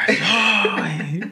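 loud laughing ay from the sideline; yelled ay. Retold or imitated. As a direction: yelp it in mock dismay. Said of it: "Y que le grito".

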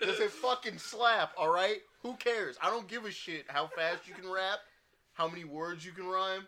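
loud, high heated rant. Real, not acted. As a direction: shout it out, fed up. Heated rant about rapping speed.

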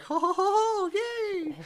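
very high, loud cartoon whoop; bad George Jetson. Delighted customer getting a spacecraft, very high cartoon voice. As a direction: squeal it out as a badly faked cartoon. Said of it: "That's probably the worst Jetson ever".

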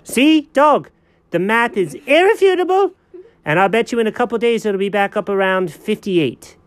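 high, triumphant cat; smug cat voice. Acted. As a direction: gloat as the know-it-all cat. Cat character in skit.

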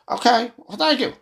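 high, abrupt mock old man signing off; hammed-up confused goodbye; mock president wandering off stage; mock Biden. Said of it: "and then all of a sudden".

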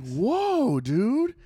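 high, drawn mock whoa; high-pitched retold surprise; taken aback. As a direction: act out being thrown by someone's temper. Taken aback by angry worker.

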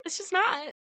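pitched well up, sassy and matter-of-fact; high mock retort. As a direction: toss it off high and knowing, "babe" included. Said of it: "And I'm like".

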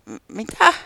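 startled sheep turning to a voice behind. Acted. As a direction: jump at a sudden voice.

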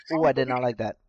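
normal talking voice

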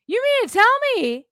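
you can't believe what you're hearing; incredulous. Real, not acted. Incredulous rant about Pelosi's office.

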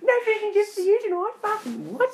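high, loud hammed-up scream; screaming mum. Friend mimicking the mum screaming at her child, high and loud. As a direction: go full hysterical mother.

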